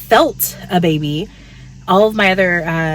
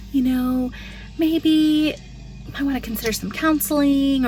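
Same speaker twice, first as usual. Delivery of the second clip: high, soft mock-pandering voice; a patronizing, high sugary suggestion; pandering people; 8 semitones higher, 4 dB quieter than usual. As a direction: talk down sweetly, pitched up. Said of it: "they just start kind of pandering".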